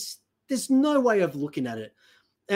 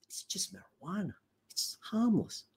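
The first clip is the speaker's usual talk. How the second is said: soft, higher dismissive voice; mock politician; 3 semitones higher, 7 dB quieter than usual. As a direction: imitate politicians softly playing it down. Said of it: "or even the Conservatives, saying".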